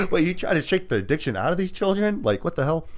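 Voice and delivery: high-pitched incredulous question; mock disbelief. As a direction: react as if you can't believe what you heard. Acts own baffled reaction.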